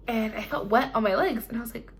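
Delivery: usual speaking voice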